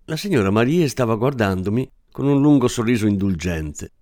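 plain reading voice

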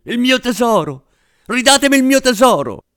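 a man cries out in anguish at the funeral, screaming. Acted. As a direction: howl for the lost treasure. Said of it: "urlò straziato".

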